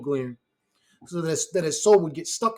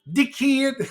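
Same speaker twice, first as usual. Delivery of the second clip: Dickhead in a laughing mock Australian accent, high; 9 semitones higher, 4 dB louder than usual. Streamer imitating how Australians say Dickhead, in a mock Australian accent. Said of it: "That shit's funny sounding".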